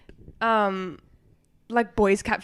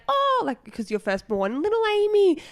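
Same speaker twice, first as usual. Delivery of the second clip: much higher cooing, adoring voice; doting parents; 7 semitones higher than usual. Retold or imitated. Adults doting on little Amy, high cooing voice. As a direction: coo over the firstborn like a proud parent.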